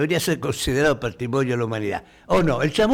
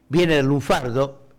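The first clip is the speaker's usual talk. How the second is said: ordinary talk, nothing marked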